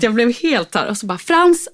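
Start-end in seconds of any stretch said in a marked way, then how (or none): 1.2-1.7 raised, higher-pitched name call; retold firm summons; firm parent. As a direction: call the child to order. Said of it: "fick jag ändå vara lite så här bestämd".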